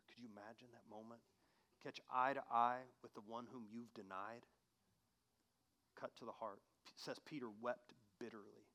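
soft, low sermon voice; quiet earnest preacher; hushed reflective preaching. Acted. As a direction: draw them in quietly.

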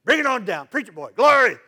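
loud church call-out, shouting praise; congregation yelling. Retold or imitated. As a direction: shout it out like an amen corner. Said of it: "They're, they're yelling at you".

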